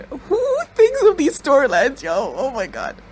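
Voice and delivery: high, drawn-out squeal of disbelief; slow, high incredulous question; incredulous amazement. Real, not acted. Amazed at the show's plot.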